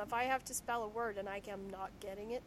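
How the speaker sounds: ordinary delivery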